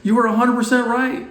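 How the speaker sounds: very high, loud, thrilled outburst; excited agreement. Retold or imitated. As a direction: jump in with total enthusiasm. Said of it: "And I was like".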